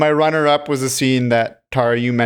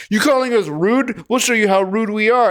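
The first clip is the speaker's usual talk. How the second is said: mock tough thugs; 8 semitones higher than usual. Retelling thugs threatening girl.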